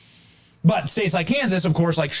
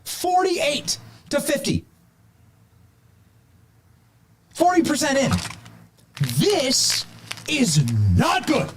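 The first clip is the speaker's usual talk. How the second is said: excited; 10 semitones higher than usual. Real, not acted. Stunned anchor reading live results.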